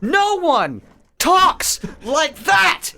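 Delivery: high, drawn-out comic outrage; half-laughing, incredulous rant; exasperated outburst. Real, not acted. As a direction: stretch out each word in high-pitched disbelief.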